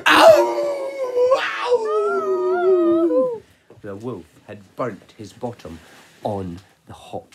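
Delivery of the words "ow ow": very high, loud gasp then pained yelps; hammed-up yelp of pain. Wolf landing in hot water, high pained yelps. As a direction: shriek like a scalded wolf. Wolf burns his bottom.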